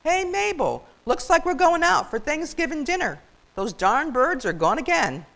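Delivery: high, loud call across the yard; calling out to wife. Acted. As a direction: shout it out to Mabel. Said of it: "He called out to his waiting wife".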